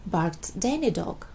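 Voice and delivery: ordinary delivery, nothing marked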